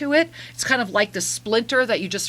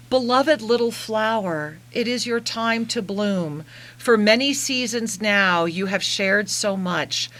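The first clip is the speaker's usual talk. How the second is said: channeled message; 4 semitones lower than usual. Acted.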